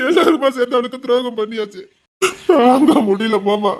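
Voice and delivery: breaking down in sobs; crying. Acted. Nephew lamenting a shared betrayal to uncle, crying. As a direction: lament through tears, drawing out the words.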